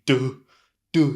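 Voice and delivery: duh, D released with an extra vowel; over-strong D. Shown as a demonstration. Teacher showing a needlessly heavy ending, over-strong D. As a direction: punch out duh as a separate syllable. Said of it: "It doesn't have to be really strong".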